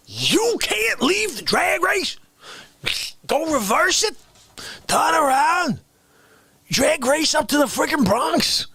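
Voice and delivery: high-pitched incredulous questions; hammed-up exasperated protest; mock indignation. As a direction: pitch each question up in disbelief. Mock indignation at leaving the race.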